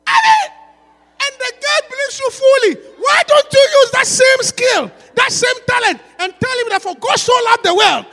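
high, loud fiery preaching; preaching shout. Real, not acted. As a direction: call the sinner to Christ with full force. Preacher exhorting at full force.

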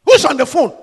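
loud, pointed question to the husband; wife snapping at husband on the phone; sharp wife demand. Acted. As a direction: call him out for the phone. Acted wife confronting husband.

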